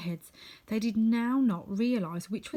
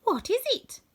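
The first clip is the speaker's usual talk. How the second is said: much higher, wide-eyed question; a girl amazed, asking eagerly; wonder; 4 dB louder than usual. Acted. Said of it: "responded Dorothy in wonder".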